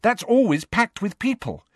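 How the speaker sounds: put-on high-pitched, defensive girl's voice. A narrator's reading. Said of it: "said Hermione defensively".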